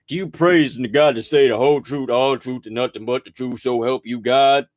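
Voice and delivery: lightly voiced court official; court oath. Ranter mimicking the oath in court, lightly voiced official. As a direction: recite the oath by rote, stepping through it. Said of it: "when you go to the court".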